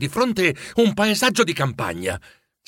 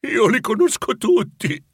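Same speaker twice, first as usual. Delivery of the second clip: moved recognition; 9 semitones higher than usual. A narrator's reading.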